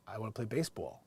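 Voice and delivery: softly mocked New Jersey baseball; New Jersey accent. Recalling his childhood baseball talk, imitated New Jersey accent. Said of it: "I had a New Jersey accent".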